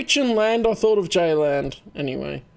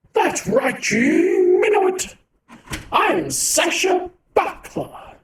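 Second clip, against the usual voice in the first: high character voice; 13 semitones higher, 5 dB louder, slower than usual. Acted. Voicing character with voice changer.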